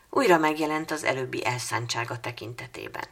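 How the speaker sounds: ordinary delivery, nothing marked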